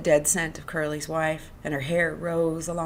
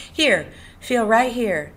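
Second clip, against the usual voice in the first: coaxing; 7 semitones higher, 6 dB louder, slower than usual. A narrator's reading. Inviting him to touch.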